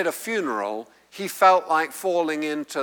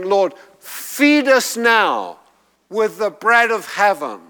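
urgent prayer; 4 semitones higher, 4 dB louder than usual. Acted. Preacher praying for the kingdom to come, urgent plea.

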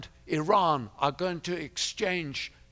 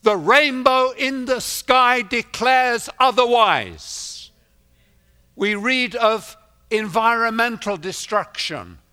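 raised, ringing pulpit delivery; fervent preaching; 7 semitones higher, 5 dB louder than usual. Real, not acted. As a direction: proclaim it to the congregation, pitched high. Preaches to congregation.

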